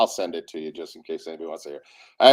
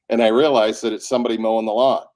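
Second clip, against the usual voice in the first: ordinary talk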